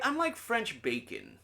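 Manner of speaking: high, drawn-out retold quip; imitated friend. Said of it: "She's like".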